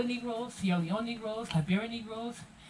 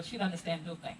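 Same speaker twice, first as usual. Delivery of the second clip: ordinary talk, nothing marked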